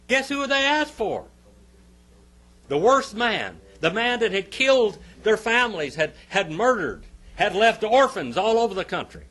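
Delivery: loud, high-pitched preacher's indictment; forceful preaching. Acted. Preacher telling congregation whom the crowd asked for, forceful preaching. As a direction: preach it hard, punching the key words.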